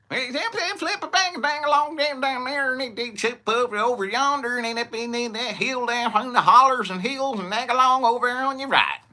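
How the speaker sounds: high, loud hillbilly directions gabble. Retold or imitated. Local giving directions, in a high mock hillbilly twang. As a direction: rattle off directions like a thick-accented local. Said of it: "ask people to repeat themselves".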